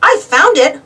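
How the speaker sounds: high, loud protest; arguing. A narrator's reading. Boy argues with his friend over the treasure, protesting. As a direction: stake a claim insistently. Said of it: "argued Arthur".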